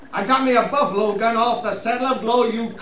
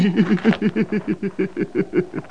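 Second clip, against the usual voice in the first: mocking laugh. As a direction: laugh him to scorn. Sniper laughs at the captain.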